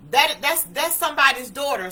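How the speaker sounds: acting out a cop's sudden realization; urgent alert. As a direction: call out to stop the others. Officer alerting the others, retold.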